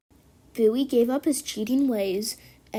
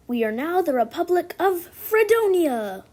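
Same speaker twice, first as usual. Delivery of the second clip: proclamation; 6 semitones higher, 5 dB louder than usual. Acted. Rebels proclaim a new republic.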